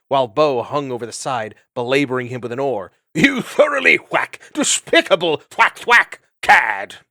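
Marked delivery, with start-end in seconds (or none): 3.1-7.0 indignant shout. Acted. Bo beating fox with oar.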